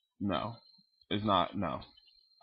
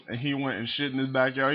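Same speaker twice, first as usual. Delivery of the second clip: ordinary talk, nothing marked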